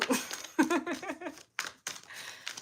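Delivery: breathy high laughter; airy laugh. Real, not acted. Recalling her panic beside crying lady, airy laugh.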